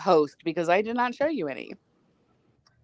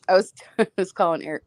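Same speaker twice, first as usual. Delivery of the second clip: normal talking voice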